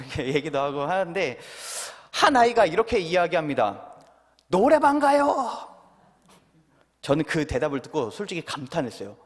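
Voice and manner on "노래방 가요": put-on child's answer.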